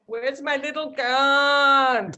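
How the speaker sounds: mock the friend's Punjabi accent, slowly. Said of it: "with my English-educated Punjabi accent".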